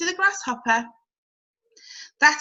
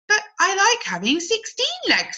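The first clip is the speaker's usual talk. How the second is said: much higher little caterpillar protesting; sticking up for her sixteen legs; 10 semitones higher than usual. Acted. Cody protests.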